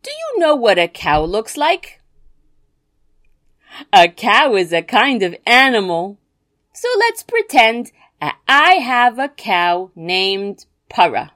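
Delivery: ordinary delivery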